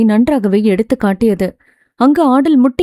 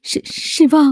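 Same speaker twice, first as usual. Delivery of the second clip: higher, softer, broken stammer; stammering through tears; 4 semitones higher, 3 dB quieter, slower than usual. A narrator's reading. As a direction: choke out his name while crying. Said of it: "திக்கி திணறி அழைத்ததும்".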